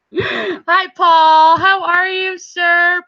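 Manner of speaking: ordinary delivery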